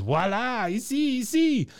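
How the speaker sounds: high, showy French-Cajun voila; Cajun French flourish. Retold or imitated. Bill showing off the family mansion in Cajun French, high and lilting. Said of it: "he goes".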